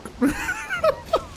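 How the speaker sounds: shrill burst of laughter; high squealing laugh. Real, not acted. Streamer cracking up at the game, a high squeal.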